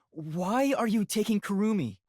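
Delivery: usual speaking voice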